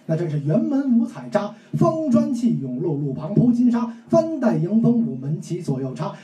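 measured storyteller recital in standard Mandarin; 普通话评书; Mandarin pingshu demo. Shown as a demonstration. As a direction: declaim it like a proper storyteller. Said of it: "我跟我哥哥是这么说的".

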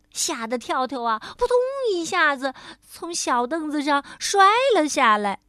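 ordinary delivery

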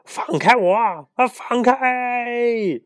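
high, strained yell to let go; struggling shout. Acted. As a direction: yell while being held back. Held by the feet, struggling.

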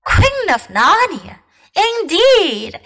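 high, scornful beaver voice; high-pitched huffy little animal; indignant beaver. A narrator's reading. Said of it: "said Miss Beaver".

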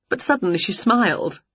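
ordinary delivery

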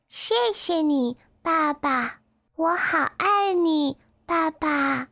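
drowsy little voice, pitched high; sleepy duckling. A narrator's reading. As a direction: murmur loving thanks as a baby duckling dozing off. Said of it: "睡眼朦胧地说". (小鸭子睡眼朦胧，细声细气)

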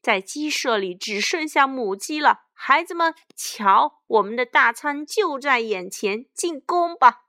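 loud, high bandit boss rallying the gang; villainous battle cry; weird gloating villain. A narrator's reading. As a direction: crow triumphantly and order the charge. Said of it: "强盗爸爸怪声笑道".

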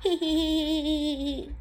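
高声得意的嘿嘿笑; 赢了一局的得意大笑; 得意的笑. Acted. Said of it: "咪咪得意地笑了". (得意地嘿嘿大笑)

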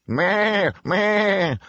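high imitated goat maa; goat's bleat. A narrator's reading. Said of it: "said the goat".

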